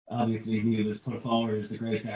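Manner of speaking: soft, indistinct mumble; mumbling. Real, not acted. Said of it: "Stop mumbling".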